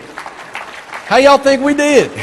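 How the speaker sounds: high, slow, teasing question; playful drawl. Real, not acted. Asking the audience about their chant, slow teasing drawl. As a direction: ham it up for the crowd.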